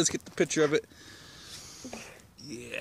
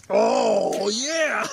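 excited cheer; 9 semitones higher than usual. Real, not acted. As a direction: cheer like it just worked.

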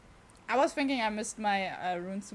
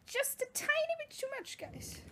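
very high, small falsetto; high-pitched, softened complaint; tiny squeaky voice; 5 dB quieter than usual. Real, not acted. Mock complaint after failed jump.

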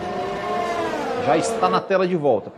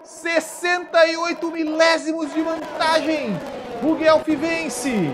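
soaring finish-line shout, much higher; excited finish call; 8 semitones higher, 3 dB louder, slower than usual. Real, not acted. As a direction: call the win at full voice. Race finish, live commentary.

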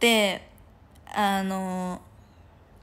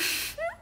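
squealing laugh; 4 dB quieter than usual. Real, not acted.

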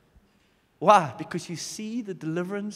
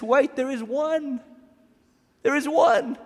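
higher, louder, building joyful news; angel's joyful announcement; 7 semitones higher, 6 dB louder, slower than usual. Acted. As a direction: announce it with rising excitement. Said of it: "the angel says".

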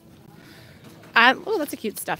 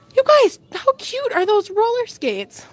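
much higher, gushing over roller skates; real delight, pitched way up; excited; 10 semitones higher than usual. Real, not acted. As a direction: squeal about how cute they are. Spots cute roller skates.

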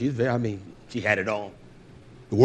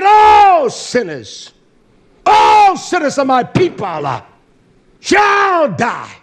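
loud, slow booming pronouncement; shouted decree; 12 semitones higher, 9 dB louder, much slower than usual. Retold or imitated. Preacher booming God's judgment on all sinners.

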